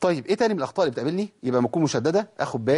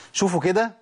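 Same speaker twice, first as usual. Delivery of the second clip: ordinary talk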